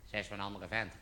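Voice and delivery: very low, hushed murmur; little inner voice. Acted. Voice in the back of his head, hushed. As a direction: murmur it like a nagging thought. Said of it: "zo'n stemmetje in je achterhoofd".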